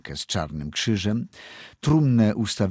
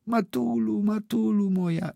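high, moaning plea; 12 semitones higher than usual. A narrator's reading. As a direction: moan it much higher, pleading. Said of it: "jęczała nieszczęśliwa dziewczyna".

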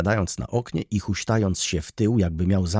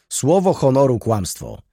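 louder, higher "Słowo honoru" in anger; angry exclamation; 5 semitones higher, 6 dB louder than usual. A narrator's reading. Student denying the steward's claim, angry. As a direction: snap back flushed with anger. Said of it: "zawołał młody człowiek, rumieniąc się z gniewu".

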